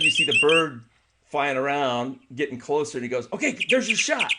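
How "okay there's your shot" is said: excited guide. Retold or imitated. Guide announcing bird arrived.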